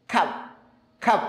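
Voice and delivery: খাপ with the mouth only one finger open; small-opening a demo. Shown as a demonstration. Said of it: "এক আঙ্গুল".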